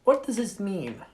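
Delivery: light, bad Russian accent. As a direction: narrate in a shaky Russian accent. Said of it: "I'll stop doing my Russian accent".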